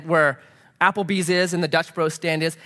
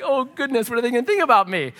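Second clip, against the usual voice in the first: higher, hurried worry played for laughs; mock dismay; 6 semitones higher than usual. Pastor retelling his worry at being accused, mock dismay. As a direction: fret comically about being misjudged. Acting own alarm at being misjudged.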